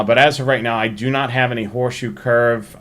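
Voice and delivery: usual speaking voice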